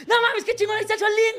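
thrilled fans. Retold or imitated. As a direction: mimic star-struck fans shrieking with glee. Fans thrilled to see Solín.

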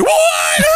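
very high mock wail; freaking-out wail. Son mimics mom freaking out on the plane, shrill mock wail. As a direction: imitate a hysterical mom wailing the word. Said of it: "freaking out".

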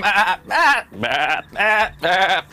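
short imitated goat bleats; goat bleating. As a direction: bleat back and forth like goats. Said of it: "all you see is, like".

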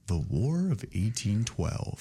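slow, droning monotone; monotone teacher. Retold or imitated. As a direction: drone it like a bored teacher. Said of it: "very, like, monotone".

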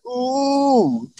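high, drawn-out ooh; a knowing little ooh; playful ooh; mock ooh. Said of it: "He's like".

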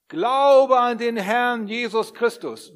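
loud, slow, high congregational chant; loud group recital. Shown as a demonstration. Preacher leading the congregation in a loud slow recital. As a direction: call it out together on the count. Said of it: "Wollen wir es mal machen? Eins, zwei, drei".

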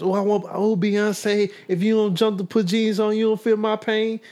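fangirl voice. Retold or imitated. Mocking fans idolizing celebrities.

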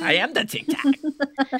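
high, slow, laughing grandma; a grandma boasting through laughter; proud grandma voice. Pretend. Playing the savvy grandma, laughing.

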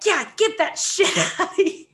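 loud, high heckle breaking into laughter; mock the irate passenger while laughing; imitated yell. Retold passenger yelling at bag retrieval.

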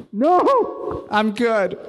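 high wail of a no, then easing down; mock-distraught, high-pitched refusal; imitating distraught woman. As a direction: act her out with head in hands. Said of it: "She holds her hand, head in her hands".

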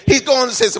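high, shouted Pentecostal-style proclamation; full-force preaching. Real, not acted. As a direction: preach it at the top of your voice.

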